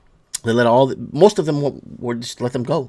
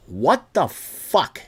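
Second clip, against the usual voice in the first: stunned; 4 semitones lower, 4 dB quieter, much slower than usual. Real, not acted. Stunned disbelief.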